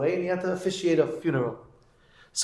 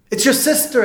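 higher, louder exclamation of disbelief; acted reproach; 8 semitones higher, 5 dB louder than usual. Rabbi to man estranged from his sister, acted reproach. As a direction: hit it high and loud, confronting him.

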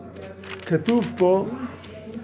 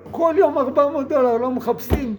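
higher, fast, exasperated scolding; scolding rebuke; 5 semitones higher than usual. Acted. Benefactor scolding the ungrateful man, exasperated. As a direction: rattle off the reproach in disbelief.

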